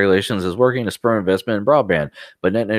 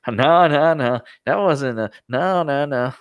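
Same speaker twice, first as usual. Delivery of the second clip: Pai's mock denial; 6 semitones higher, slower than usual. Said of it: "And Pai's like".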